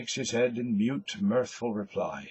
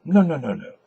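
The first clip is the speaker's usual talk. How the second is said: loud insistent no's, stepping up; raised repeated denial; firm protest; 5 semitones higher, 10 dB louder, faster than usual. Acted. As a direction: push back hard, louder each time. Bloom protests to Corny.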